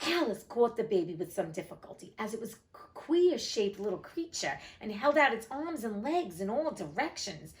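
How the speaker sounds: plain reading voice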